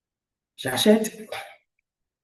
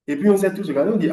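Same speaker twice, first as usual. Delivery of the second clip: ordinary delivery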